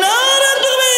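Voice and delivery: very high-pitched rallying cry; full-force slogan cry. Real, not acted. Preacher demanding a stronger takbir, full-force cry. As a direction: push the takbir up to a piercing call. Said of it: "জোর দিয়ে বলি".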